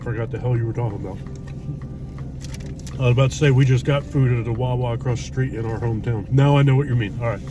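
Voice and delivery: ordinary delivery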